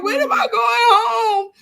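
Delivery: high, loud tearful wail; drawn-out, hammed-up crying plea; mock crying. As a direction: imitate sobbing out a question, half laughing. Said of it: "and I'm crying".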